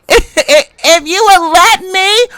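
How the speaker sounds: "if you would let me" high and drawn out slowly; mocking sing-song. Real, not acted. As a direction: stretch it out high to mock her. Mocking after a laugh.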